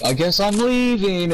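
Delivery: high, slow mock-sad cat; Cat in the Hat voice. Retelling the scene, a high slow sulky cat. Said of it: "And he's like".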